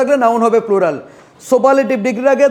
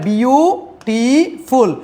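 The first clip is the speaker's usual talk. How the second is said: Beautiful very slow, with a gap after বিউ and after টি; teacher's drawn-out syllable count on beautiful; broken into syllables; much slower than usual. Shown as a demonstration. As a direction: break beautiful into three long, separate pieces. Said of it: "তিনবার ভাঙতে পারি".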